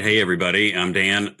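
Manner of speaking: ordinary talk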